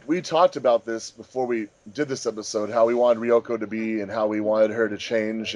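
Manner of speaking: ordinary talk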